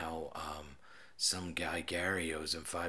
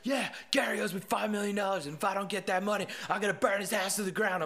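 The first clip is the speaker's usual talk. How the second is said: high, loud, fast mock rant, higher pitched; shouting kid; 12 semitones higher, 3 dB louder than usual. Kid shouts his threat at the officer through the door, high and loud.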